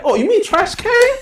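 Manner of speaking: high-pitched incredulous question; coworkers' dawning question. Retold or imitated. Coworkers finally get what he meant, voiced high and questioning.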